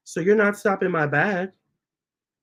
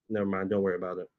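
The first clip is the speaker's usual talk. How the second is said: muttered aside; 8 semitones lower, 9 dB quieter, much faster than usual. Real, not acted. Trails off, dropping the topic.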